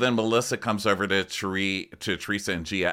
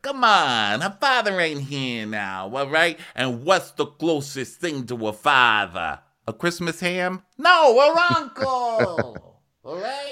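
higher, louder coaxing; mock Tony; 8 semitones higher, 5 dB louder, slower than usual. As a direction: persuade the guys, pitched up. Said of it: "Tony's like".

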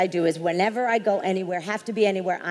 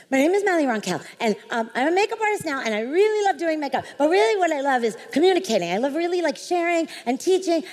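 high, fast gushing ramble; nervous babbling; 5 semitones higher, much faster than usual. Retold or imitated. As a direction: rush every word out before she walks away. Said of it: "the diarrhea of the mouth just flows".

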